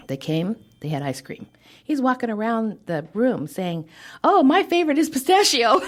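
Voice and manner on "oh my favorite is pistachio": excited. Retold or imitated. Boss cheerfully mingling with staff.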